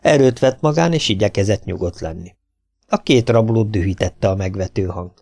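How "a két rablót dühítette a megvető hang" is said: ordinary delivery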